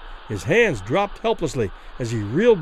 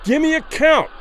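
high, loud demand to the referee; raised-voice, urgent shout; hoarse cry; 10 semitones higher, 5 dB louder than usual. A narrator's reading. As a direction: cry it out like a spent fighter. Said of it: "Rivera cried hoarsely to the referee".

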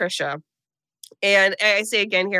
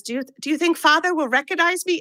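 hammed-up foreign child accent, pitched higher; Esther's put-on accent; 6 semitones higher than usual. Host imitating Esther asking about Father, in a mock accent. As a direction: mimic Esther's odd accent badly on purpose. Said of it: "I'm so sorry about this accent".

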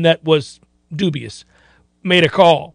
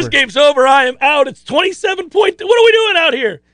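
mock exasperated official; 8 semitones higher than usual. Host echoing, voicing the official.

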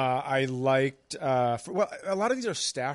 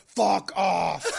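louder, higher comic outburst; mock angry retort; 6 semitones higher, 6 dB louder than usual. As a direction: play an offended blind man snapping back. Imagined blind man's retort.

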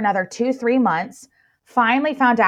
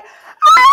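high, loud joyful shriek, much higher and louder; scream of joy; 13 dB louder than usual. Retold or imitated. Finalists hearing the news, shrieking with joy. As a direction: let out a thrilled shriek. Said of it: "Like, screaming".

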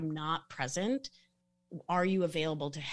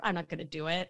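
ordinary talk

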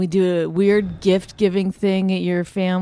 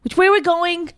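high, loud, demanding mimicry; mocking rude imitation; 11 semitones higher, 5 dB louder than usual. Woman shows partner how it sounded, rude and loud. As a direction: throw it out as a pushy demand. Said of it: "You say it like this".

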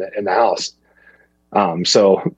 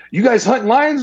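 high, eager imitation of the homeowner; freaking out; 11 semitones higher than usual. Homeowner asking the hunters if they hunt lions, freaking out. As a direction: quote him worked up, pitch way up. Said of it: "He starts freaking out".

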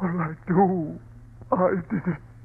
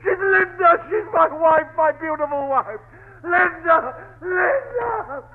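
sobbing cries for his wife; anguished sobbing; 10 semitones higher than usual. Acted. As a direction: break down in grief. Husband breaking down.

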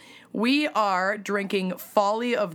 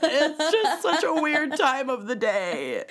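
high giggly voice; words squeezed out through giggles; laughing while talking; 9 semitones higher than usual. Real, not acted.

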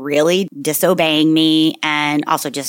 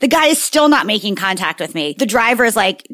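ordinary talk

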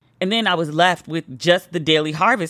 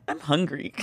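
sad I'm hungry; 3 semitones lower, 8 dB quieter than usual. Retold or imitated. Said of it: "she looked so sad".

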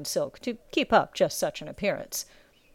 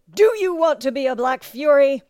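raised, louder drill master yell; bellow; 6 semitones higher, 7 dB louder than usual. A narrator's reading. Drill master testing recruit's will, bellowing. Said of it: "such a bellow to come".

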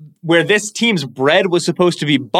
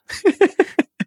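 burst of laughter. Real, not acted.

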